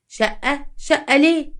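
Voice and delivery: acted astonishment; surprised questioning. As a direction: query it with rising disbelief. Neighbour questions surprising flat purchase.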